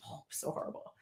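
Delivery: breathy whisper of mock horror at the ruined turkey; hushed dismay. Telling the kids' Thanksgiving story, whispered horror. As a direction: gasp it out softly, like reliving the disaster. Retelling turkey rolling on floor.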